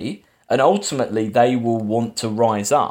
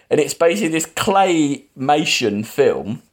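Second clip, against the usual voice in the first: ordinary talk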